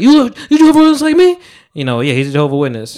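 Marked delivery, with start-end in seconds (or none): 0.0-1.4 high, loud, quick mimicry; Charlamagne impression. Quoting a radio host's favorite question, high-pitched mimicry. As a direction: blurt it high and fast, imitating someone's catchphrase. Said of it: "he's like".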